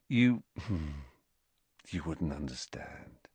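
softly sighed, low and defeated; sighing. A narrator's reading. Ron tells Harry he wouldn't understand, sighing. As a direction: sigh it out quietly, low in the voice. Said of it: "Ron sighed again".

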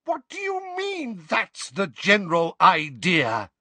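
very high, slow outraged shriek. A narrator's reading. Wife shrieking at her husband behind the door. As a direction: shriek it in disbelief. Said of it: "they heard Missus Weasley shriek".

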